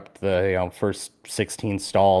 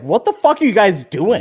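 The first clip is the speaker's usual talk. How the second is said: mock yell; 10 semitones higher, 4 dB louder than usual. Security staff yelling, retold.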